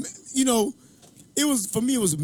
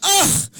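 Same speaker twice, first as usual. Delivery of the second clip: high, loud re-enacted yell; sudden mock yell, pitched way up; shooter's yell; 13 semitones higher, 7 dB louder than usual. Point guard gunning down opponents, mock yell. Acting out his shot on court.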